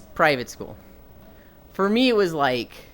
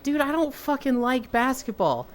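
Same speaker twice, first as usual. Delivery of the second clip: retold protest; 8 semitones higher than usual. Acting out his protest to team.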